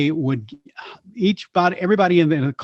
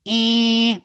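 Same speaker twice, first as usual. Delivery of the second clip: higher Bugs Bunny eh; Bugs Bunny key; 8 semitones higher than usual. Shown as a demonstration. Teaching the host Bugs Bunny, higher demo eh. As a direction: find the Bugs Bunny pitch. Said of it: "to do Bugs Bunny, you start with".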